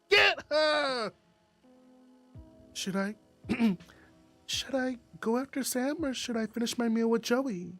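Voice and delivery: usual speaking voice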